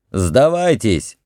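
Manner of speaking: mild, drawn-out shout; shouted demand. A narrator's reading. Heir to fleeing Libyans, mild shouted demand. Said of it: "крикнул наследник".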